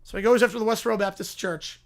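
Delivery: ordinary delivery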